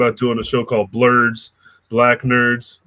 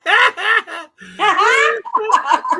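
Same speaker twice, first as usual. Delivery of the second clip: big shouted laugh. Real, not acted. Laughs at being given light.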